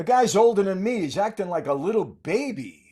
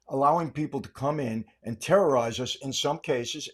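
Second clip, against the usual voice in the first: usual speaking voice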